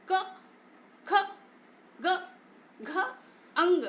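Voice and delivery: क ख ग घ अंग sounded from the throat; consonant demo. Shown as a demonstration. Teacher recites the क group for students. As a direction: sound each क-वर्ग letter clearly. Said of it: "जब हम बोलते हैं".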